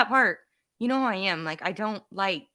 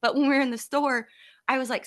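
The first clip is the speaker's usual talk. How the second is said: ordinary delivery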